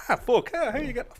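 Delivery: high, flustered starstruck gush; nervous high-pitched fan gushing. Retold or imitated. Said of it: "you're kinda like".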